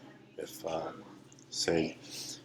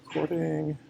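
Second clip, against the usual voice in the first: sing-song call; 10 semitones higher than usual. Real, not acted. Announcing start of recording.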